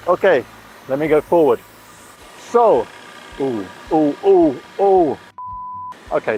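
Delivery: ordinary talk, nothing marked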